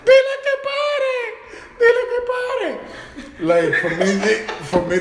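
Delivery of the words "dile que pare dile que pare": very high-pitched frantic cry; mock panicked plea. Chased friend begs for the dog to stop, comically panicked. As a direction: squeal for help like someone being chased, hammed-up.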